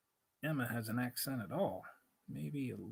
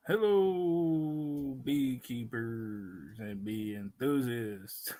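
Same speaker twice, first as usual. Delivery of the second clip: announcer greeting; 4 dB louder, much slower than usual. Acted. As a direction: call out to the viewers like a show host. Greeting the livestream audience.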